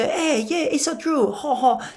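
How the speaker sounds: high mimicked French accent; taking on French accent. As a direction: echo the kid in hammed-up French. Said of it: "start to take on their accent".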